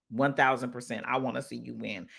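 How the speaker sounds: low, soft, heartfelt promise; sincere reassurance. Real, not acted. Sincere repeat of her support.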